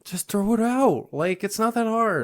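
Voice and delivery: high-pitched incredulous protest; exasperated. Real, not acted. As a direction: voice climbs high in comic exasperation. Exasperated at abandoned sandwich.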